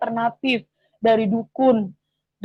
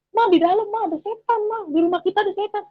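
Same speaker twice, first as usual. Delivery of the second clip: high-pitched, urgent child's report, imitated; retold alarm; 9 semitones higher than usual. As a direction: warn Mum in a high, mildly panicked voice. Child reports devil to mother.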